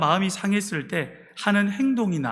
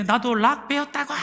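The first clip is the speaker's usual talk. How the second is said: raised-pitch imitation of someone yelling; mock yelling; 5 semitones higher than usual. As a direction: mimic an indignant outburst, higher but only half shouted. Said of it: "소리를 팍 지르면서".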